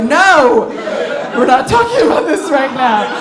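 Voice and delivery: loud, high burst of mock alarm; panicked refusal. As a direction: shut it down with a hammed-up yell. Retold panicked refusal to husband.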